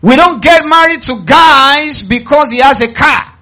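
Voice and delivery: high, shouted preaching warning; loud exhortation. Real, not acted. Preacher warning women about marrying for a car, loud exhortation. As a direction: preach it at the top of the voice. Shouted take, then repeated calmly.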